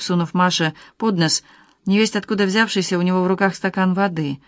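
ordinary delivery, nothing marked

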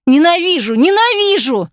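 high-pitched hateful outcry; impassioned burst of hatred; with deep feeling. A narrator's reading. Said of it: "говорила это с таким чувством".

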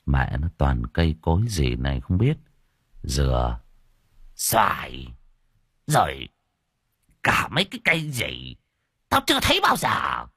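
put-on low grumbling old fellow; a crude old man muttering at strange trees; gruff old man. A narrator's reading. Old Đồng grumbles at unfamiliar trees, low gruff voice.

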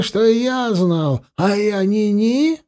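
ordinary delivery, nothing marked

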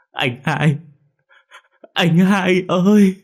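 high, loud, drawn-out wail; a child crying out over his brother's body. A narrator's reading. Boy wails at dead brother's body, drawn-out cry. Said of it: "Nó run run mà gào khóc".

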